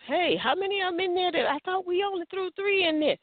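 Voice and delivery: high-pitched puzzled disbelief; astonished onlooker. Acted. Onlooker counting men in the furnace, astonished. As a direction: pitch it up in acted amazement.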